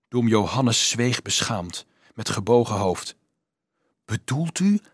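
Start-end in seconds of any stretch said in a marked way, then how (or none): 4.0-4.8 aghast question, pitched up without extra volume; understated dismay, higher voice. A narrator's reading. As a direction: ask in horrified disbelief. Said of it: "fluisterde Dolf ontzet".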